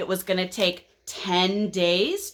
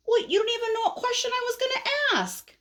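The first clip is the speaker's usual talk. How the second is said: high, fast whiny protest; protesting caterpillar; faster than usual. Acted. Caterpillar is cut off before asking, protesting. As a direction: object in a high, rushed whine. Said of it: "And so this guy says".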